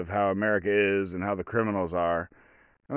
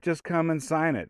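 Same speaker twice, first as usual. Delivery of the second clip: high, sweet coaxing cop voice; conciliatory tone; 7 semitones higher than usual. Retold or imitated. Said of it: "kinda tell by the tone of voice".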